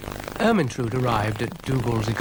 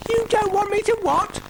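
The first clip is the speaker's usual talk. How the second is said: very high panicky squeal; panicking; 3 dB louder than usual. A narrator's reading. Brian hears Dougal say not to panic, panicking squeal. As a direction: shoot right up in alarm.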